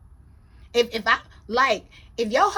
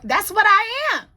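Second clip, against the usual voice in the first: raised, high-pitched protest; worked-up, emphatic bragging complaint; indignant self-defence; 6 semitones higher, 5 dB louder than usual. Real, not acted. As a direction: insist on your due, loud at first. Defending own award-winning title.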